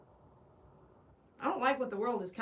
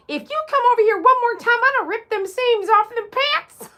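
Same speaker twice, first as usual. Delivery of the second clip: much higher, much louder hammed-up threat; mock angry threat; 11 semitones higher, 12 dB louder than usual. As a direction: bellow a silly threat in a put-on voice.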